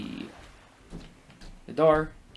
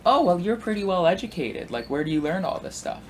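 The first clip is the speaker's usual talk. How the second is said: high, mock-impressed older man; imitated tongue-in-cheek wonder; poking fun; 7 semitones higher than usual. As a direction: feign admiration to tease them, pitched up. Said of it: "he's poking fun at them".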